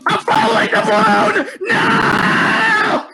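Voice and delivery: high frantic yell; panicked shout. Real, not acted. Panics in game.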